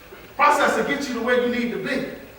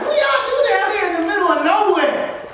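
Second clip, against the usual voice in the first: higher, lightly voiced young girl; student girl asking from California, lightly imitated; mimicking a girl; 9 semitones higher than usual. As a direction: quote her question up high and puzzled.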